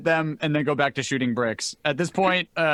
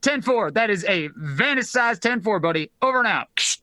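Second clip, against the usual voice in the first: funny radio-protocol voice; 3 semitones higher than usual. Acted. Closing the comm call, funny radio voice. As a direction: sign off like a CB operator. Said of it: "if I sound a bit funny".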